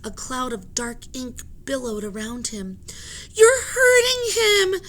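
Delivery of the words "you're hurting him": high, loud, slow crying protest; tearful yell. Acted. Annie yells at the sailors, high, loud and tearful. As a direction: yell it through tears. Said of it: "yelled Annie".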